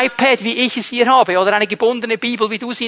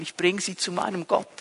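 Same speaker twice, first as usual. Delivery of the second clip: ordinary talk, nothing marked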